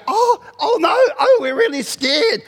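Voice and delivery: high-pitched mock panic; badly faked, hammed-up fright; pretend fear. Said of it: "act like they're scared".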